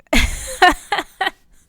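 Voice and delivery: loud bursting laugh, high; a real, loud laugh. Real, not acted. Laughs at her own story.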